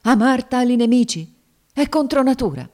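high, loud indignation; fierce exclamation. A narrator's reading. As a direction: protest in outraged disbelief. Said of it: "esclamò la donna con un truce sguardo".

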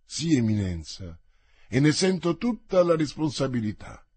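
ordinary delivery

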